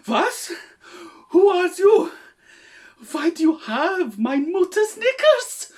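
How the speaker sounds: high, loud shocked cry; overacted disbelief, German-ish; hammy shock. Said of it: "let's try something a little bit more simple".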